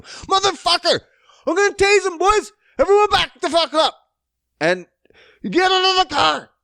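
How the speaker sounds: very high, loud retold screaming; officer yelling. Officer screaming at the driver to get out, loud yell. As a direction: scream it like a cop losing control. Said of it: "She's talking to him".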